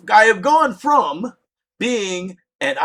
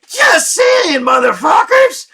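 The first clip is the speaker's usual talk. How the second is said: high, loud ranting yell; exasperated shout; 9 semitones higher, 7 dB louder, slower than usual. Real, not acted. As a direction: blow up at everyone's stupidity. Ranting about standing by building.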